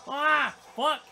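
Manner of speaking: sudden high, loud outburst; loud curse. Real, not acted. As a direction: blurt it out in pain. Gets hit in game.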